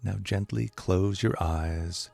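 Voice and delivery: calm, unhurried meditation voice with long pauses; guided meditation. Acted. Teacher guiding listeners to relax, calm meditation voice.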